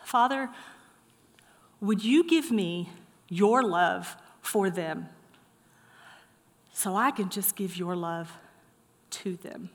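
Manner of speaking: slow, hushed plea with long pauses; prayer. Acted. Preacher modelling a prayer to the Father, hushed pleading. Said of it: "you prayed this prayer".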